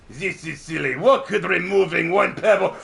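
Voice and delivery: scoffing French cartoon villain. Villain scoffing at removing one pebble from the dam, mock French accent. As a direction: dismiss it in a hammed-up French accent. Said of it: "And he's like".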